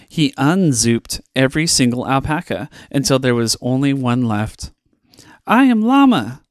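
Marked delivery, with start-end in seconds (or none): 5.4-6.4 very high, loud llama announcement; Llama's voice. A narrator's reading. Llama faces the last alpaca, proclaiming his name. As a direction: proclaim your name grandly. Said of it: "said Llama".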